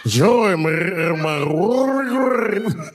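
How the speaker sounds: slow, high gargled delivery; exaggerated throaty, drawn-out spook voice; rolled-throat joke voice. Shown as a demonstration. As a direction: roll your throat, stretching every word. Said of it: "you gotta roll your throat".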